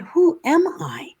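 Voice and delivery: high, slow, lost question; searching question. Retold or imitated. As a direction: ask yourself who you are, bewildered. Questioning herself.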